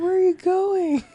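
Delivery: high, put-on questioning call; acted film line. Re-enacting a film exchange.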